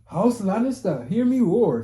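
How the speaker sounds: mock Lannister proclamation. Fan quoting Game of Thrones at the chat, hammed-up proclamation. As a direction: ham up a lord's battle cry.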